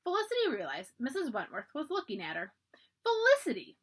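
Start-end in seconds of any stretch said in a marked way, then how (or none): 3.0-3.8 much higher and louder, puffing out the name; a huffy matron calling the girl; puffed. A narrator's reading. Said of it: "Missus Wentworth puffed".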